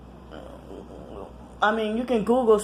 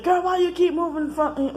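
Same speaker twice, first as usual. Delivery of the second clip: mocking brother; 12 semitones higher than usual. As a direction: mimic the brother on the phone. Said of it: "no, he said".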